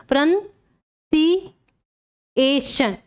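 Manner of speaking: slow, careful प्रोनंसिएशन with "nun"; correct pronunciation. Shown as a demonstration. Teacher repeats the right pronunciation slowly for students. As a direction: drag out प्रोनंसिएशन syllable by syllable, pro-nun-si-ay-shun. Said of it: "ये सही पढ़ने का तरीका है".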